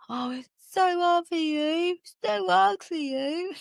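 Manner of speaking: high, slow, sing-song fake sympathy; mock pity voice. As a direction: drag out so hard in a high pitying whine. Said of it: "Love the drama of that".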